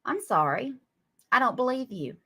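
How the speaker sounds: usual speaking voice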